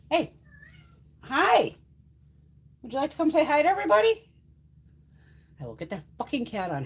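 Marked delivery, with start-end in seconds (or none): 0.1-4.3 high, fast, louder cooing to a cat; pet talk. Real, not acted. Inviting her cat to greet viewers, high sing-song pet talk.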